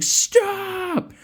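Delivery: high, sharp shouted command. Retold or imitated. Kel ordering the stone to stop the cutthroats, high shout. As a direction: fling the word at the attackers.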